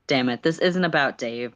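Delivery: ordinary delivery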